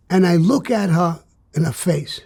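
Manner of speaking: normal talking voice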